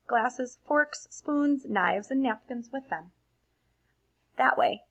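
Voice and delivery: put-on lively read-aloud for children; expressive storybook reading to a class; story narration. A narrator's reading. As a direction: read it like a bedtime picture book. Reads picture book aloud.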